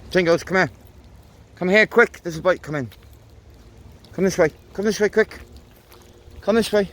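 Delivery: high calling voice to a dog. Real, not acted. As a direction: call the dog over, urgent.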